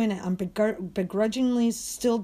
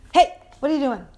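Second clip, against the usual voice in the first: loud, higher-pitched call to a dog; sharp scolding; 5 semitones higher, 8 dB louder, faster than usual. Real, not acted. Owner catches dog licking, sharp loud scolding.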